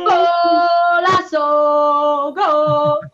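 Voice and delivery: drawn-out, sung goal cry; goal call. Pretend. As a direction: hold the vowel like a commentator.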